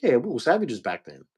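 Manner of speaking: quiet, offhand confession; quiet matter-of-fact admission. Retold or imitated. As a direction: own up to it quietly. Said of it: "it's like".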